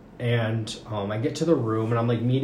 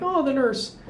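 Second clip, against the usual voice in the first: mock hopeful oh; 14 semitones higher than usual. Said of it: "I'm like".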